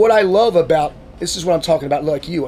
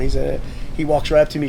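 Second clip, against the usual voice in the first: ordinary delivery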